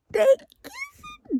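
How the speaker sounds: high squeal, slowed, nearly crying; real squeal of shock while reading; excited squeal. Real, not acted. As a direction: shriek with shocked delight as you read it. Reacting to a kiss in book.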